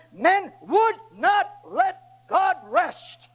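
"men would not let God rest" drawn out slowly, word by word; slow weighted line. Acted. Preacher lamenting to the congregation, slow and weighted. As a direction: hold each word out slowly.

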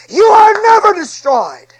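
high, loud, slow ringing cry; preaching shout. Acted. Preacher shouting the promise to the congregation, high and slow. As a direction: hurl the promise to the back row.

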